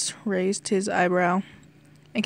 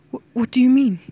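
loud, high, stammering shock; 4 semitones higher, 8 dB louder, faster than usual. A narrator's reading. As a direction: blurt it out in shock. Shocked at the news.

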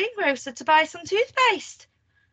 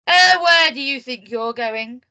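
loud, slow challenge; commanding call, words drawn out; bossy cry; 9 dB louder than usual. A narrator's reading. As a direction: catch someone sneaking off. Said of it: "cried little Miss Bossy".

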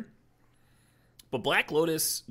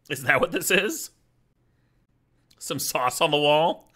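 amused disbelief; 4 semitones higher, 6 dB louder than usual. Real, not acted. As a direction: drag it out in mock disbelief. Joking about the card art.

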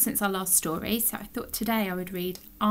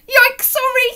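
high, loud yelp of apology; startled little bear; 9 dB louder than usual. Acted. Alan told off by Magic Rabbit, startled bear. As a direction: yelp it, caught out.

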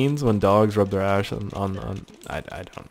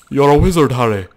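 film line quote; 3 semitones higher, 8 dB louder than usual. Acted. Quotes film line on seeing wizard.

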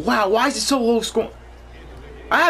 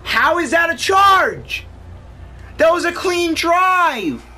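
slow, higher, louder outrage; angry protest; 10 semitones higher, 3 dB louder, slower than usual. Real, not acted. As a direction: insist it was clean. Outraged at charge call.

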